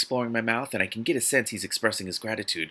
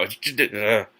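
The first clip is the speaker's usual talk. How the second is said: ordinary talk, nothing marked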